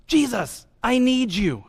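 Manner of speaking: high-pitched plea without full volume; imitated calling out. Blind man calling Jesus, high and pleading. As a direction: call to him from the roadside. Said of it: "he shouted even louder".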